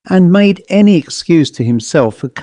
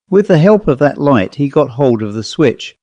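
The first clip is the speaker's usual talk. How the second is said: plain reading voice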